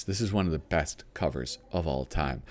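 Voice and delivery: ordinary talk, nothing marked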